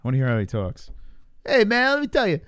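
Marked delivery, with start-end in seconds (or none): 1.4-2.4 high-pitched mock helium voice. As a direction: imitate a fighter with a squeaky-high helium voice. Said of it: "he's got a helium voice".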